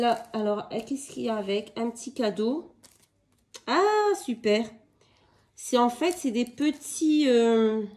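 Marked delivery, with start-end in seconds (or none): 3.7-4.7 high, slow, delighted "ah, super"; light up at a surprise gift. Real, not acted. Finding a little gift in the parcel, slow and delighted.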